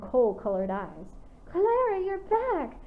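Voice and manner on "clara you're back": very high, delighted greeting; happy shout. A narrator's reading. Young wolf greeting returning Clara, happy shout. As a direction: squeal her name with joy. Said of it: "shouted happily".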